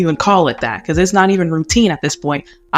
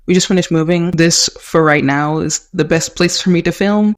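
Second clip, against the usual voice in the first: ordinary talk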